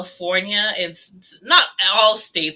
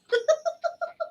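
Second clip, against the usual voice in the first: squeaky burst of laughter; high laugh; 6 dB quieter than usual. Real, not acted. Laughing at the absurd gang assumption, a high squeaky giggle. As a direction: let a high giggle escape.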